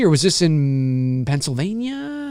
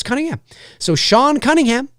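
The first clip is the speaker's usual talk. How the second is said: normal talking voice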